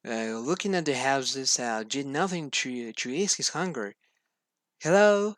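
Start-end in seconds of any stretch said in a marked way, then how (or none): 4.8-5.4 loud call into the empty clearing. A narrator's reading. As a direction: call out hello to anyone near. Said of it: "he called".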